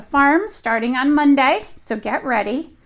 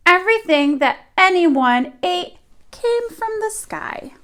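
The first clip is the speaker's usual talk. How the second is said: put-on lively read-aloud voice for young children; storybook reading; 3 semitones higher, 4 dB louder than usual. A narrator's reading. Teacher reading a picture book to her class, lively storytelling. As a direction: tell the tale to the class as if they sit on the rug.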